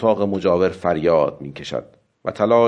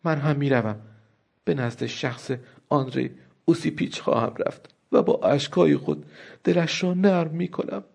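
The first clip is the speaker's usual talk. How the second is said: higher and softer, a plaintive whine; moaning; 6 semitones higher, 4 dB quieter than usual. A narrator's reading. Wife whimpers to her husband that she will go plead. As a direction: wail it softly, on the verge of tears. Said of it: "النا ناله کنان گفت".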